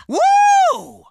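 high, loud whoop; high, loud cheer of joy; victory whoop. Acted. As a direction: whoop like you just won.

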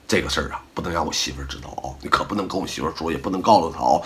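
low, soft, quick conspiratorial murmur; hushed secret request. Retold or imitated. A friend quietly begs the host not to tell his wife, hushed. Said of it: "他告你了啊".

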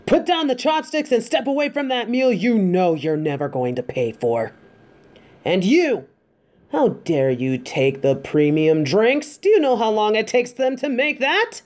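high, indignant telling-off of the wolves; stern scolding. Acted. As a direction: scold them like a furious master catching thieves. Tigress scolds freeloading wolves.

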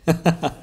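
short gloating laugh; a brief, pleased chuckle; amused chuckle. Acted. Villain enjoys the listener's shock at the fire, amused chuckle.

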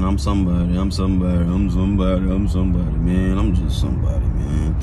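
low, mopey repeated self-talk; sad ass tone. Shown as a demonstration. Rapper coaching fans, demoing sad self-talk in a low mope. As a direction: droop it like a sad mantra. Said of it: "in that sad ass tone".

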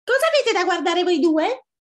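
very high, loud little fox; soaked small fox snapping; little fox, indignant. Acted. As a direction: demand it in an angry squeak.

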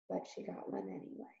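ordinary delivery

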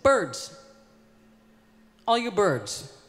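projecting to a flock, half-acted; calling out to the birds. Poet calling out to crowd.

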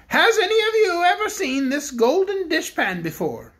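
the Bear King addresses the cook; kindly Bear King. Acted. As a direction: speak as a gentle storybook king. The king asks the bears.

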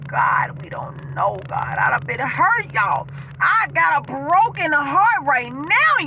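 ordinary delivery

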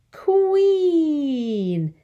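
"queen" with a very long, high, falling ee; long E stretched. Shown as a demonstration. Teacher giving an example word, "queen" drawn out on the vowel.

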